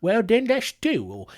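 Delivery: hammed-up British voice, a bit resigned; mock British accused man. Accused man accepts a duel, mock British accent. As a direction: put on a bad British accent, going along with it. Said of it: "he was just like".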